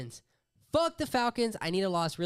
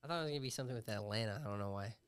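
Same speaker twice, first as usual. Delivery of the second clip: normal talking voice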